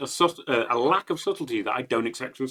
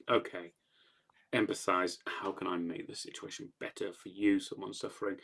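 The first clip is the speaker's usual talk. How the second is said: softly caring; 4 semitones lower, 8 dB quieter than usual. Retold or imitated. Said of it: "Like any human being would do".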